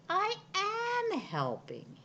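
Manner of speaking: high, slow, pleased peacock voice; peacock thinking. A narrator's reading. Peacock glad to be helping. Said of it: "he thought".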